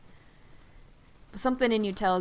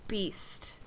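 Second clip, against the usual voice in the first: whisper; 7 dB quieter than usual. Acted. GM voices the beast being stilled.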